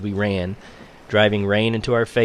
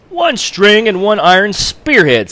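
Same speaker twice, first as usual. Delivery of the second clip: higher, louder and slow, pressing the deal; stern captain; 11 semitones higher, 8 dB louder than usual. A narrator's reading. Russian captain pressing the trader, higher, louder, slow. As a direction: drive the bargain hard, word by word.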